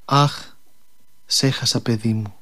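mild storyteller's sigh of loss, sinking low; sighing lament. A narrator's reading. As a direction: sigh it out like a mother losing her child. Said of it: "αναστέναξε και φώναξε".

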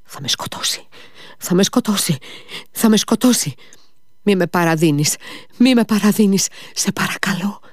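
put-on panicking old man begging for his life; terrified cry. A narrator's reading. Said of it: "φώναξε αρπαγμένος". (τρομαγμένη κραυγή που δυναμώνει)

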